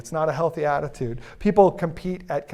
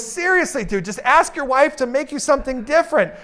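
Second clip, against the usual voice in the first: exasperated; 8 semitones higher than usual. Retold or imitated. Coworker exasperated.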